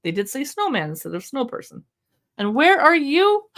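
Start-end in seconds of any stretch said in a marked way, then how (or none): 2.4-3.5 higher, louder, slow teasing question; enthusiastic read-aloud. Shown as a demonstration. Asking the baby where they are, slow eager build-up. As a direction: build up to the mirror surprise. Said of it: "read it with an enthusiastic tone of voice".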